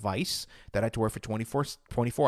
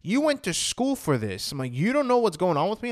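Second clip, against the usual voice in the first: high, louder indignant protest; stretched, emphatic incredulity, pitch raised; freaked-out disbelief; 7 semitones higher, 4 dB louder than usual. Retold or imitated. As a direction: re-enact freaking out at the therapist. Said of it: "I freaked out because".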